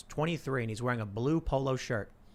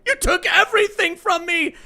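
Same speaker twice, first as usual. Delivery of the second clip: high, loud, shaking rage; tearful furious yell, much higher; furious accusation; 10 dB louder than usual. Acted. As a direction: scream it at the founder at gunpoint. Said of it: "And he's like".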